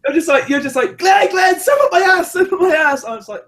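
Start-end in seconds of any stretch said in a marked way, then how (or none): 1.0-3.0 high, loud imitation of a pest; excitable high mock shouting; mock pestering shout. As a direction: yell it like a nagging mate, hammed-up. Mimicking Jason pestering Glenn.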